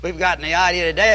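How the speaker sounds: ordinary talk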